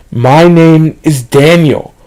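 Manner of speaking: loud, high, drawn-out shout; emphatic shout. A narrator's reading. Narrator answers the thing through the door, shouting emphatically. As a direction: shout it slowly, word by word. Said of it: "I said again".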